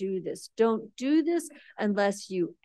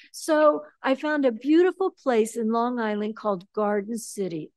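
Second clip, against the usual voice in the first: ordinary talk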